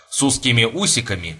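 ordinary delivery

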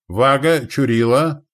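high, loud crew command; barked order. A narrator's reading. Crew commander has the Скорпион turned, barking loudly. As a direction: roar out names and order with urgency. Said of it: "рявкнул командир расчёта".

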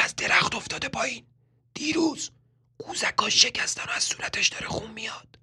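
hushed whisper behind the hand; urgent whisper to Marilyn. Acted. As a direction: cover the phone and whisper.

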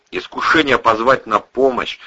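ordinary delivery, nothing marked

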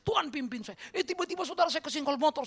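high, excited testimony voice; breathless-style mock church testimony told loudly; hammed-up believer telling a mishap.

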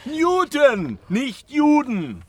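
high loud, slow emphatic correction; loud drawn-out correction; exasperated correction. Acted. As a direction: spell it out angrily. Shouted correction, slowed.

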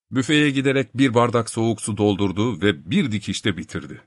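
usual speaking voice

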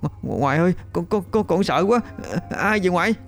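put-on a scared child clinging to his grandma; frightened stammering boy. A narrator's reading. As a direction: stammer it out, terrified and small. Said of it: "Nó run cầm cập".

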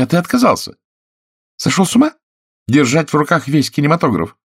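high, loud woman's voice rising to indignation; exasperated actress. A narrator's reading. Actress rebukes Oleg for refusing a post, exasperated. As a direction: press him, increasingly incredulous.

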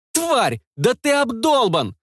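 put-on high child's voice yelling; bratty kid screaming high; childlike shout. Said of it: "почти детский крик".

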